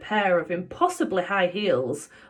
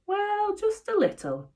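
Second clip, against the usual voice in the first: chuckling; 13 semitones higher than usual. Acted. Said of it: "Dad chuckled".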